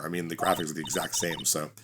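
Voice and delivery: usual speaking voice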